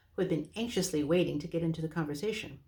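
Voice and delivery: ordinary delivery, nothing marked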